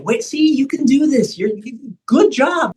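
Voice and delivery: high upbeat praise. Retold or imitated. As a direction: lift them with a bright "good job". Coach praise retold.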